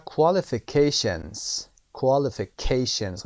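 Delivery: Qualifications with ca said loud and slow; stress on CA. Shown as a demonstration. Teacher drills Qualifications, stressing CA for students. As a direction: stretch and push ca in Qualifications.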